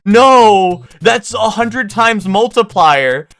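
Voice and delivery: high, slow, emphatic insisting; insistent no. Retold or imitated. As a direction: press hard, stretching out the multiplier. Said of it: "and Ken's like".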